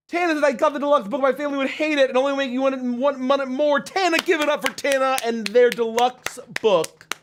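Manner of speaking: high, hurried hype-host read; excited shout-out. Real, not acted. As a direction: rattle it off and rally applause. Hyped shout-out to a backer.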